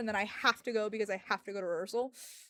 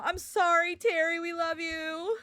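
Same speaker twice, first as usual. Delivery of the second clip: tearful sorry; 5 semitones higher, 6 dB louder, slower than usual. Real, not acted. Crying farewell.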